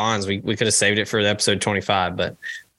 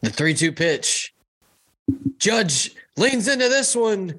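higher, slow mock play-by-play; Michael Kay call; 10 semitones higher, slower than usual. Mimicking the TV play-by-play guy calling a home run, higher and slow. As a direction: build the pitch like a TV announcer. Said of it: "What's his catchphrase?".

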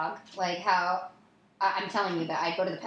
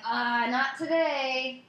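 slow, high, teasing "not today"; coy drawn-out brush-off; flirty drawl; 6 semitones higher, much slower than usual. Retold or imitated. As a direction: stretch "Uh, not today" out coyly. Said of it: "And I was like".

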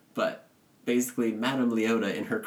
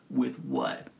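deadpan joke voice; 9 semitones lower than usual. Retold or imitated. Joking that the crystal ball has no hands, lower deadpan.

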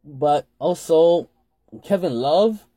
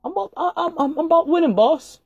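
high mock voice; 14 semitones higher than usual. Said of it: "Andrew Wiggins looked at him and said".

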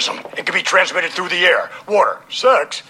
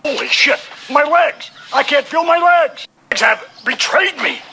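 shouting while wounded; 7 semitones higher than usual. Retold or imitated. McMahon collapses with torn legs.

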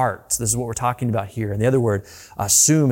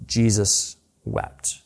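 slow hushed; 3 semitones lower, much slower than usual. Acted. Repeats key verse softly.

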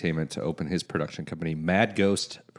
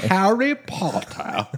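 mock English accent; 12 semitones higher, much slower than usual. Joking British voices.

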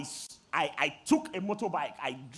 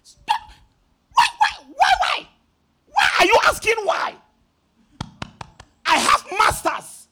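screaming; 12 semitones higher, 11 dB louder than usual. Retold or imitated. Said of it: "Apostle, he screamed".

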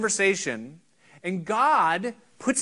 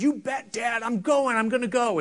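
higher-pitched, eager yes to Dad; eager son; 6 semitones higher than usual. Acted. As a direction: agree readily, pitched up like a willing kid. Eager son answering his father.

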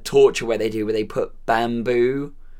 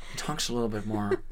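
softer, fast laid-back Cage voice; Nicolas Cage voice; 5 semitones lower, 10 dB quieter, faster than usual. Retold or imitated. Showing his laid-back Nicolas Cage take. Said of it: "my Nicolas Cage is".

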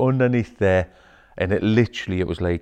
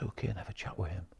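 quick whisper; 16 dB quieter, faster than usual. Retold or imitated. Retold whispered instruction.

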